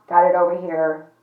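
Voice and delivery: normal talking voice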